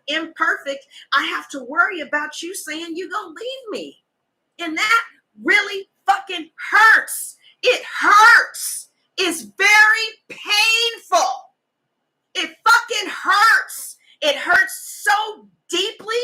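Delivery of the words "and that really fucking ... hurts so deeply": hurt crying out. Real, not acted. Speaker overwhelmed by hurt.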